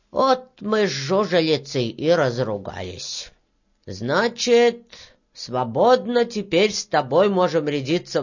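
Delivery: put-on low, unhurried old man's voice; grey-haired old man, settled. Old worker invites Varya to talk, settled.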